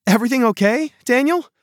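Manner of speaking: put-on worried girl. A narrator's reading. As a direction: ask with real worry. Worried friend asks.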